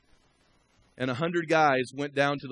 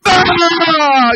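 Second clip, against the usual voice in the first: long, high full-throated war cry; war whoop; 22 dB louder than usual. Retold or imitated. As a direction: let out a battle yell at full force. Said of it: "We just gave a war whoop out".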